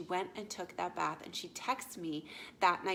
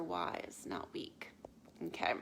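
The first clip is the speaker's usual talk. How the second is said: hushed, breathy whisper of the point; whispered aside; 5 dB quieter, slower than usual. Real, not acted. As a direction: drop to a confiding whisper. Coach landing her key point.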